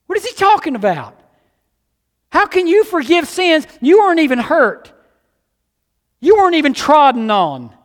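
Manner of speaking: high, loud, fervent preaching; full-force preaching. Acted. Pastor pressing the congregation on forgiveness, fervent and loud. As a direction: drive it home to the congregation.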